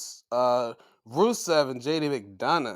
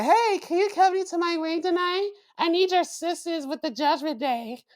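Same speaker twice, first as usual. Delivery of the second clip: high, chirpy mock-sweet voice; bubbly wrestler; 14 semitones higher than usual. Wrestler cheerfully inviting a rival into her ring, high and bubbly. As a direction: pitch it way up, bubbly and sing-song.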